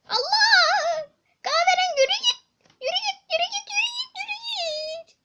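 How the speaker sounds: high, loud, laughing yells; laughing shouts. Real, not acted. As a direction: shoo him off while cracking up. Kahverengiyi kovalıyor, gülerek bağırıyor. (gülerek tiz bağırış)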